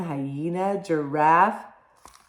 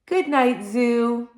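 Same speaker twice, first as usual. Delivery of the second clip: wife's goodnight; 5 semitones higher, 5 dB louder, slower than usual. Acted. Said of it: "she walks back home and she says".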